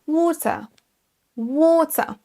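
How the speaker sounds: water in British, long aw and no final r; British accent. Shown as a demonstration. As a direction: say water the British way, like waw-tuh. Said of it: "avec l'accent britannique".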